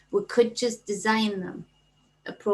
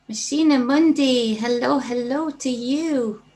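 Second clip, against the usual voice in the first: excited greeting; 5 semitones higher, 8 dB louder than usual. Real, not acted. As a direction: sing out the welcome warmly. Sing-song loud greeting to a viewer.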